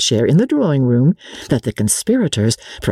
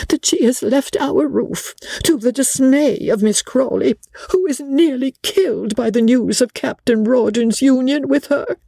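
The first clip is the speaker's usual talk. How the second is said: high-pitched, dismayed lady's voice; agitated protest in a high voice; flustered companion; 11 semitones higher than usual. Acted. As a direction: keep it high and fretful to the end. Reader voices agitated Briggs.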